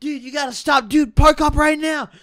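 high, loud, urgent demand; freaking out. Retold or imitated. Imitating a friend yelling at the driver, high and loud. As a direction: yell at the driver to pull over now. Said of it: "this guy's freaking out".

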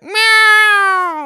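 very high, loud, long yowl; heart-attack cat meow. Retold or imitated. Cat wakes his napping owner, piercing loud meow. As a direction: screech a drawn-out meow to jolt a sleeper awake. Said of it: "meows that could give you a heart attack".

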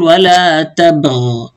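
ولا تبغ stopped on the غ, final ya dropped; slow demo of تبغ ending dead on the غ; pausal form without final ya. Shown as a demonstration. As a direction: say تبغ cutting the vowel off after غ. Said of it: "فبقف عليه بحذف حرف العلة".

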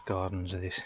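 normal talking voice

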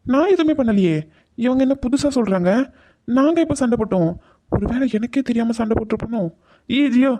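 baffled protest. Acted. Man blamed for a quarrel he never had, baffled protest. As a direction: protest innocence with the pitch pushed up.

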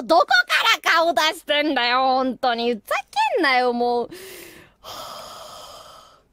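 normal talking voice

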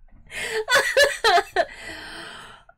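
high, loud peals of laughter; real laughing fit. Real, not acted. Learner unable to copy the funny pronunciation, helpless laughter. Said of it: "I can't do it".

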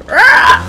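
sudden high yell rah; imitated game scream. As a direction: belt out a mock monster roar.

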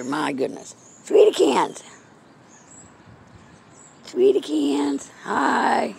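ordinary talk, nothing marked

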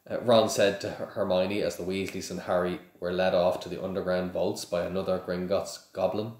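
plain reading voice

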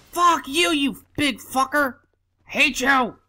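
high-pitched rant at an enemy; real gamer rage, pitched high; angry cursing. Real, not acted.

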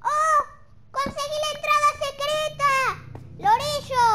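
high, loud triumphant cry; excited shout. Real, not acted. Player finding the secret entrance and calling his parrot, loud excited yell. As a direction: yell the discovery out.

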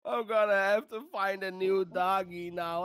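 ordinary talk, nothing marked

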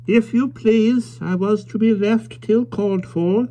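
put-on slow, mild and high old man; politely mild, unhurried; mild old stranger. A narrator's reading. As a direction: speak as a gentle elderly stranger. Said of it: "said the stranger mildly".

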